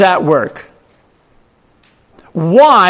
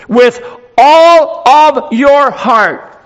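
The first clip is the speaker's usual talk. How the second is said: each word drawn out slowly and higher; slow emphatic quote; 4 semitones higher, much slower than usual. Acted. Pastor to congregation, quoting God very slowly and emphatically. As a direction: lay down God's command word by word. Pastor stressing God's words.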